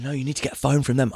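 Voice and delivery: lightly voiced girlfriend pushing for a phone; girlfriend's urgent plea. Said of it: "she keeps going".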